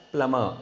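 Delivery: plumber as "plummer", no B sound; silent B. Shown as a demonstration. Teacher repeating the right form for learners, plumber with silent B. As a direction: say plumber with only M in the middle. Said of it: "B is a silent word".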